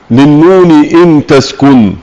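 slow, measured verse recitation of "للنون إن تسكن"; reciting the verse. Acted. Teacher reads the poet's verse to students, slow recitation. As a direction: intone the poet's line slowly, chant-like. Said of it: "قول الناظم رحمه الله تعالى".

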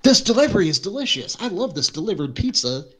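high mock party guest. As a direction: gush like a guest. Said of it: "everybody was like".

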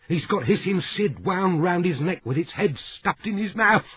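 a distraught cockney woman blurting horror; hysterical housekeeper. A narrator's reading.

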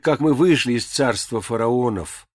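plain reading voice

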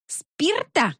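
high, incredulous echo; bristling disbelief. A narrator's reading. Woman bristling at alcohol in the smoke, high incredulous question. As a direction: throw the word back in shocked challenge. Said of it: "набычилась Роза Жановна".

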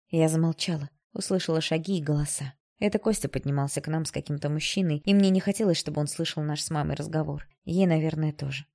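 ordinary delivery

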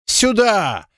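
high, indignant cry; outraged, high-pitched call; full-throated wail. A narrator's reading. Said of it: "завопил во всё горло".

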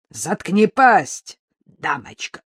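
loud, slow threat, dropping at the end; menacing order. A narrator's reading. Mugger silencing a screaming woman, menacing.

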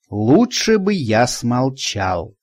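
ordinary delivery, nothing marked

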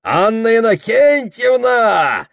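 high loud drawn-out tipsy call. Acted. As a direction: call out to her, a bit drunk. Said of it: "взывает захмелевший Прохор".

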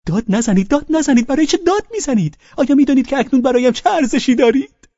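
frantic high, loud protest; hurried, urgent. A narrator's reading. Guest begs the host to stop shouting, hurried. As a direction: rush in high-pitched to stop him. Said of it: "با عجله گفت".

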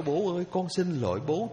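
put-on a child hugging father, openly pleading sorry; pleading apology. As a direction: call out the apology as if hugging him now. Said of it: "ôm bố lại và nói".

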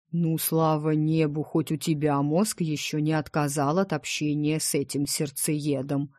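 ordinary delivery, nothing marked

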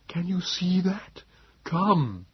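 high, slow, whispery creature voice; Nebogipfel. A narrator's reading. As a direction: coax weakly on little breath. Wheezing Morlock tugs and urges.

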